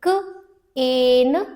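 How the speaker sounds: "क, एन" slowly, a gap between; lone "क", then "एन" with a long vowel; sounding out. Shown as a demonstration. Teacher shows a magic E word, sounding out "क, एन". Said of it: "long vowel sound क्या है".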